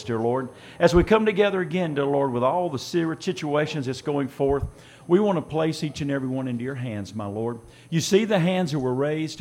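usual speaking voice

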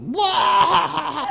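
high, long burst of mock laughter; imitated laughing outburst. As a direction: imitate someone exploding with laughter. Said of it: "He was laughing so hard".

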